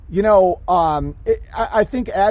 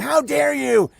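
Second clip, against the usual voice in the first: higher-pitched imitation of outraged people; mock an indignant crowd, voice pitched up; mock outrage; 7 semitones higher, slower than usual. Retelling how people react from their pits, mock outrage. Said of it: "people are like".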